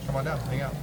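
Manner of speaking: normal talking voice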